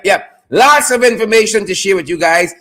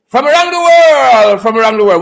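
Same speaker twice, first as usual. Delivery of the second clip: announcer intro; 2 semitones higher, 4 dB louder than usual. Acted. Announcer-style segment intro.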